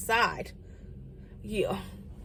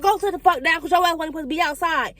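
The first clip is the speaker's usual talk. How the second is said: mama scolding; 6 semitones higher, 4 dB louder than usual. Retold or imitated. Mama ordering child to sit.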